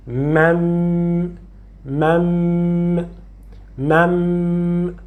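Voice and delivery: mem, the final m held as a long nasal hum; lengthened second m. Shown as a demonstration. Teacher repeating mem for the student, second m stretched. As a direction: draw out the closing m of mem. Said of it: "O alongamento está no segundo som".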